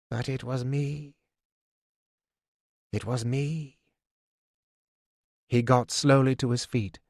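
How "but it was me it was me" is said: soft, hollow admission; barely voicing his guilt; sad and quiet. Fool admitting betrayal to Fitz, quiet and sad. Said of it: "He shook his head sadly".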